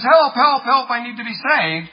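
high, loud mock plea of a drowning man; acted cry for help. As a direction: call out urgently, hammed-up panic.

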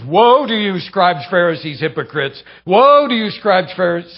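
preacher booming Jesus's rebuke; thundering woe. Retold or imitated. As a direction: hurl the woes at them. Jesus denouncing Pharisees.

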